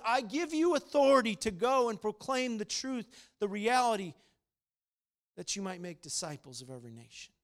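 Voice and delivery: loud, rising preacher's proclamation; preaching at full force. Acted. Pastor proclaiming God's grace to his congregation, preaching at full force. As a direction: proclaim the scripture to the whole room.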